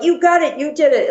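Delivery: high retold cheer, no real volume; cheering supporter. Teammate telling the runner the goal is made, excited. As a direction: act out an elated fan. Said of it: "And he's like".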